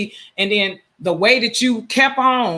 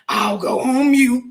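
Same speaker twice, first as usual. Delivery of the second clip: slow, whiny mock of "I'll go on mute"; a snide parody of the caller, drawn out; mocking impression; 5 semitones higher, slower than usual. Host mimics the caller's whiny "I'll go on mute". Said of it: "gonna keep saying".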